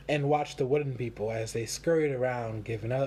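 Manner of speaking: ordinary delivery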